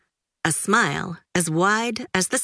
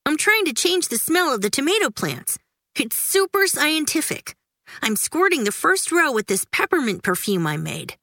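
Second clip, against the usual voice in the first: put-on high, eager little-boy voice; proud Stink; 8 semitones higher than usual. A narrator's reading. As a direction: show off his science like a kid expert.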